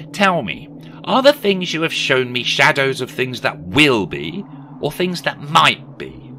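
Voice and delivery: high, loud, dramatic questioning; anxious Scrooge pleading. Acted. Scrooge questioning the silent spirit, pleading.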